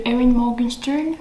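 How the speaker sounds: Erin Morgenstern said the English way; English accent. Shown as a demonstration. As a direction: give the name a light English accent. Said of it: "avec l'accent anglais ou l'accent français". ("Erin Morgenstern" à l'anglaise, avec l'accent anglais)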